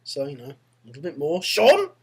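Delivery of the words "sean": high, loud, rising trench call; shouted call. Acted. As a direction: call out searching for someone. Third call along the trench.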